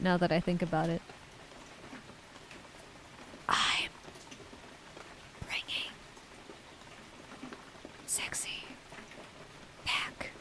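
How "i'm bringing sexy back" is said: breathy whispered song lyrics; whispering. Pretend. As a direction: whisper the lyrics ASMR style. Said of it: "I just start whispering the lyrics of songs".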